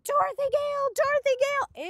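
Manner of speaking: high, loud mock screech; imitated screaming. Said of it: "they're all screaming".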